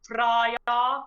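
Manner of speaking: Freya drawn out with a broad ah, rising; careful Norse-style Freya, lifted at the end; correct pronunciation. Shown as a demonstration. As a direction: say Freya as FRAH-yah, carefully stretched. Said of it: "which I should be saying".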